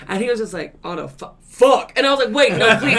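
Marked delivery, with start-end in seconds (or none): 0.8-1.9 loud, drawn-out dismayed curse; dismayed realization. Retold or imitated. Caller realizing it's a debt collector, loud dismay. 2.3-3.0 loud, high plea through laughter; hurried pleading. Retold or imitated. Agent begging the caller to stay on, pleading.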